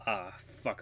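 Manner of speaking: normal talking voice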